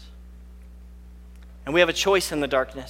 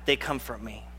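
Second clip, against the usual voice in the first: soft fade; 7 semitones lower, 11 dB quieter than usual. Acted. Psalm line fades softly.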